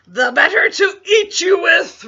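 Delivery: high, very loud, ferocious shout; wolf's roar. A narrator's reading. Wolf leaping at Little Red, roaring. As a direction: roar it as a pouncing beast. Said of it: "roared the wolf".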